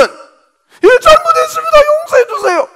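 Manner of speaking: high, loud, crying beg; whining plea. Retold or imitated. Second defendant wailing to the judge, high and tearful. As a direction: wail for mercy in mock tears. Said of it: "너는".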